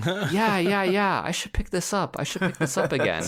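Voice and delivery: high-pitched, keen self-quote; high excited burst of agreement; eager retold reaction. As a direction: act out jumping back in, voice way up. Acts out eagerly resuming the game.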